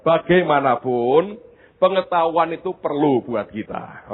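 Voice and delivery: normal talking voice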